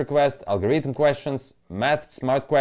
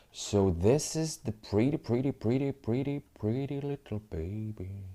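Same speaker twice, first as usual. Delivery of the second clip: quiet, fading coo over something pretty; softly cooing; 8 dB quieter than usual. Real, not acted. Trader admiring the chart, soft fading coo.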